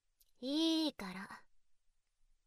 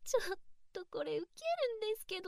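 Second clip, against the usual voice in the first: high amused mockery; 10 semitones higher, faster than usual. Mocking his begging.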